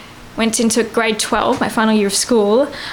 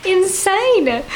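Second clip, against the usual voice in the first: shocked; 7 semitones higher, slower than usual. Retold or imitated. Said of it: "I was just like".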